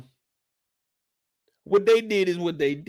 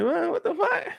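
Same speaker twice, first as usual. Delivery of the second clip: shocked what; 10 semitones higher, 3 dB quieter than usual. Retold or imitated. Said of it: "I'm like".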